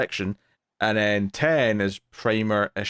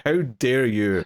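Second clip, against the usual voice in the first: tag triggered; slower than usual. Retold or imitated. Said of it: "I was so triggered".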